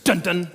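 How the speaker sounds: loud two-note "dun, dun" show sting; dramatic end-of-scene sting, done by mouth; imitated TV sting. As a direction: punch out the crime-show sound effect. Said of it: "And it goes".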